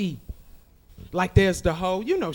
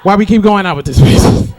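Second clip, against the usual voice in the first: louder exasperated complaint; mock fed-up girls; 10 dB louder than usual. A touch of girls complaining loudly in the bathroom. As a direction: vent about her in the bathroom. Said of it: "with the other girls like".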